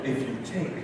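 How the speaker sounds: a hint of stiff, hushed egghead talk; lightly mocking how smart people talk. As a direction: whisper it like a pompous scholar, laughing. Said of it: "I thought that's how they talk".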